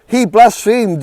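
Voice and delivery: high, loud outraged shout again at full force; repeated furious yell. Acted. Chief priest to the council, repeated furious shout. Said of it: "he yelled".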